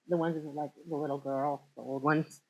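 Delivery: usual speaking voice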